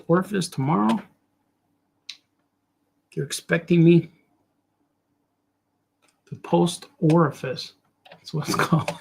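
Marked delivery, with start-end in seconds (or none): none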